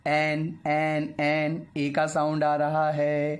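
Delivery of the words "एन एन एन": "एन" drawn out slowly, repeated to show the vowel start; slow vowel-sound demo. Shown as a demonstration. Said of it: "vowel sound".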